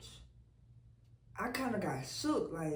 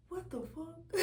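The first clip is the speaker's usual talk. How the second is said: startled; 8 semitones higher, 5 dB quieter than usual. Retold or imitated. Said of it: "I'm thinking".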